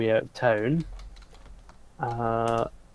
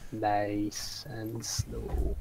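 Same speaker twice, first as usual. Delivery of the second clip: slow menacing; 6 dB quieter, much slower than usual. Acted. Griffin orders slowly.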